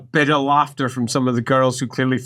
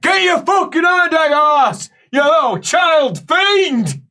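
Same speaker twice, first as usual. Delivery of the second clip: acted outraged yell; 5 dB louder than usual. Neighbour yelling at a child, acted outraged shout. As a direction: bellow abuse at the one treating you. Another patient yelling from next bed.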